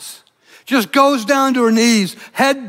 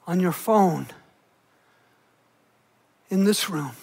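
soft personal appeal; 4 semitones lower, 6 dB quieter than usual. Acted. Preacher asking viewers at home if they believe, soft and tender. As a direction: speak to one listener in their living room, intimately.

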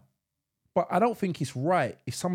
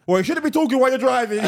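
a touch of smug lecturing, slightly louder; smug driver; 4 semitones higher, 5 dB louder, faster than usual. Retold or imitated. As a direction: play him correcting you primly. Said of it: "This is him".

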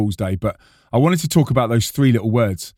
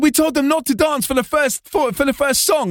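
high-pitched prankster confession; Flo; 13 semitones higher than usual. Retold or imitated. Prankster repeating his no-dancing instruction, high voice. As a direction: imitate a pleased friend in a high voice.

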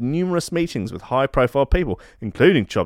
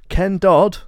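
ordinary talk, nothing marked

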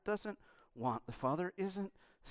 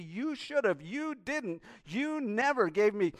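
grudging accusation; 7 semitones higher than usual. Acted. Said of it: "recounting these things against me".

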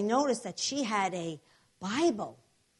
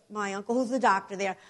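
usual speaking voice

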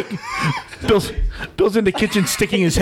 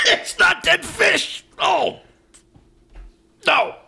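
disgusted strained voice; 13 semitones higher, slower than usual. Real, not acted. Just ate a vile bean.

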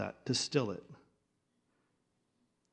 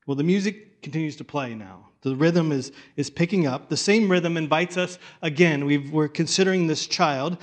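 loud, driving sermon voice; full-force preaching; 7 dB louder than usual. Acted. Pastor preaching over the music to the congregation, loud and driving. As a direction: push it out over the band like a closing call.

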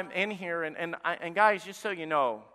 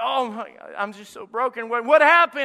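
rising to a loud high cry; imitated distress; 7 semitones higher, 11 dB louder than usual. Pastor retelling a desperate caller, mock distraught cry. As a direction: imitate a broken caller's outburst.

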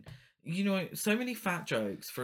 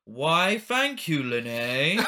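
principal's voice; 7 dB louder, much slower than usual. Retold or imitated. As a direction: ham up a grand old principal thanking her. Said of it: "this is my favourite".

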